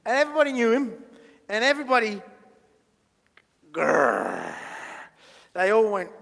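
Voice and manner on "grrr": long, very low growl; angry growl. Acted. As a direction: growl deep like a cross crowd.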